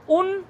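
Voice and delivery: उन as a short, high, separate syllable; n first. Shown as a demonstration. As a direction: stop on the n of उन, no r yet. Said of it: "पहले नकार का उच्चारण करो". (उन में पहले नकार का उच्चारण)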